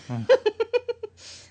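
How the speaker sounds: high, squealing laugh; high shrieking laugh. Real, not acted. Laughing at own joke.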